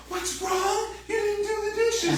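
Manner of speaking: very high singsong snippy mimicry; mock sarcastic wife. Wife mocking husband about the dishes, singsong sarcasm. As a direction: sing it back with sneering attitude. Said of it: "she puts on an attitude".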